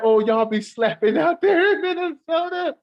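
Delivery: high, slow words squeezed out mid-laugh; quoting through laughter. Retold or imitated. Telling the stream what he said, laughing helplessly. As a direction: repeat the comment while still cracking up. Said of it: "He said".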